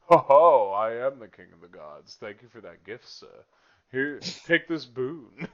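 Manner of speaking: lightly played king of the gods, big hearty opening; Zeus. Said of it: "And then there's Zeus, and he's like".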